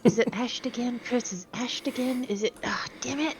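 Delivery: cartoon villain cursing under breath; muttered under breath. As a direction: mutter the curses, foiled villain. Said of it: "Under my breath".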